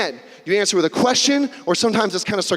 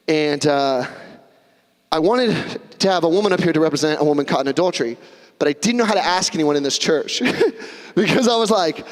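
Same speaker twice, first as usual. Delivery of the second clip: normal talking voice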